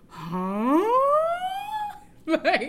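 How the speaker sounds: incredulous huh breaking into a high laugh; high disbelieving laugh at an absurd demand; baffled huh and laugh. Real, not acted. Telling her friend about his text, baffled laughing.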